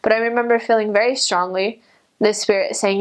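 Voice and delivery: normal talking voice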